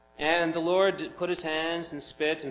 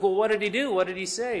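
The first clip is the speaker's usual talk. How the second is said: louder, higher, eager asking; curious townsfolk pressing for the story; excited crowd question; 7 semitones higher, 8 dB louder than usual. Retold or imitated. Bystanders quizzing the healed man, eager and louder.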